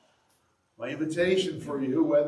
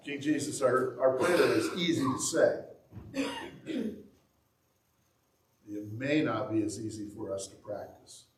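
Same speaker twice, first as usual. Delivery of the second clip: prayer. Acted. Leads closing prayer.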